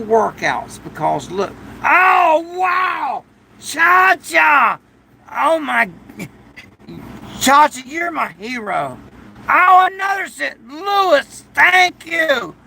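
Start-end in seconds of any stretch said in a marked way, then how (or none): 1.8-9.0 real excitement, pitched way up; excited thanks. Real, not acted. Thrilled by a super chat. 9.5-12.5 real joy at a gift, voice jumping up; excited thanks. Real, not acted. Thanking for another donation.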